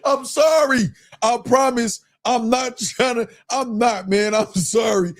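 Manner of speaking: cracking up while apologizing; real laughter. Real, not acted. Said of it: "I'm literally crying".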